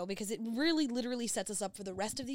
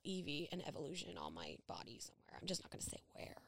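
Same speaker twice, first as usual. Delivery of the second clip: hushed conspiratorial whisper; 6 semitones lower, 12 dB quieter, faster than usual. Real, not acted. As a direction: whisper it like a secret. Secret tattoo location.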